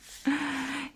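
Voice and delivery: short, high little laugh; amused giggle. Acted. Amused by the human's features.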